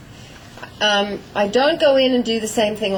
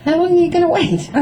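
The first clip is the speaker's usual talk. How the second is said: impatient horse; 8 semitones higher, 4 dB louder, faster than usual. Retold or imitated. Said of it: "finally he goes".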